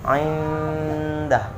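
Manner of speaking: indah with the n hummed long; correct nasal hold. Shown as a demonstration. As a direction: hum into the d of indah. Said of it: "sekali lagi". (indah dengan dengung)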